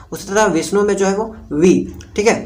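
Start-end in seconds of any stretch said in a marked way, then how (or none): 1.5-1.7 वी set apart with a firm push; stressed syllable. Shown as a demonstration. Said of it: "थोड़ा जोर से इसको जो है वो बोलना". (वी पर जोर देकर)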